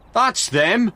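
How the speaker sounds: loud, high excited shout. A narrator's reading. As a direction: yell it out as he spots the proof. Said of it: "shouted Frank".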